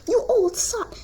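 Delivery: high mock yell; a hammed-up insult; insulting shout. Percy insulting the teacher, high mock yell. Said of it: "he called the teacher".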